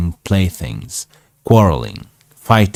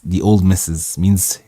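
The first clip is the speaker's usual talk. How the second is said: ordinary delivery, nothing marked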